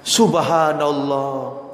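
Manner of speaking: slow, low, drawn-out marvelling; hushed awe. Real, not acted. Preacher marvels at the father's wish, awed. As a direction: stretch it out in reverent wonder.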